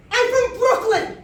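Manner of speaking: high, loud outburst; blurted shout. Retold or imitated. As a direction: burst out with it at full voice. Blurted answer after stammering.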